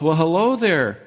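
acted farmer sizing up a stranger; puzzled farmer. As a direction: greet a stranger uncertainly, pitch climbing on the question. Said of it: "And the farmer goes".

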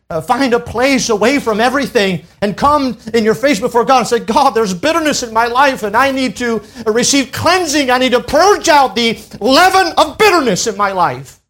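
high, pressing sermon voice, building to the end; fervent preaching. Acted. Preacher showing mothers how to confess bitterness, fervent preaching. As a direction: plead it out like a preacher in prayer.

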